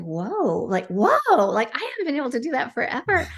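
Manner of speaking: rising wonder, then a fast, high rush; amazed whoa. Retold or imitated. Client testing her healed shoulders, amazed and rushing. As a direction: let the amazement spill out quickly. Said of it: "She's like".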